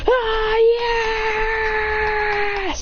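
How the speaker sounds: high, long triumphant cry; re-enacted victory scream. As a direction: hold the cheer high and long.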